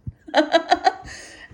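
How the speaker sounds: real laugh, high and bright; high burst of laughter. Real, not acted. She laughs at the pavilion man's compliment. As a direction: laugh out loud at the memory.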